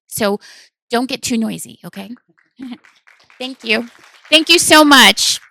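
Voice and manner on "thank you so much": big, slow called-out thanks; loud thanks. Real, not acted. Calling out thanks to audience.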